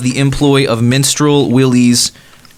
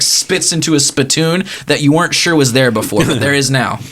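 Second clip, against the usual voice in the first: ordinary talk, nothing marked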